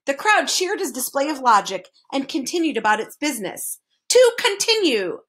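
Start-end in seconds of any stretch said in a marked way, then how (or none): 4.1-5.2 high, emphatic resumption; pointedly impatient minister. A narrator's reading. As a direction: cut back in to move things along. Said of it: "continued the minister impatiently".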